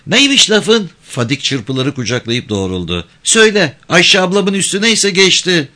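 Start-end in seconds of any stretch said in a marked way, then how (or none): 0.1-0.9 put-on high-pitched girl's voice; high little reply; village girl. A narrator's reading. Girl challenges the man. 3.2-5.7 put-on high-pitched, pert girl's voice; high young voice standing up to a man; defiant village girl. A narrator's reading.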